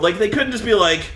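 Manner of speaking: usual speaking voice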